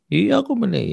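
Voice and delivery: lebih tinggi, anak kecil merajuk; suara anak. Retold or imitated. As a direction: jawab seperti anak kecil. Anak menjawab ayah. (suara anak kecil, lebih tinggi)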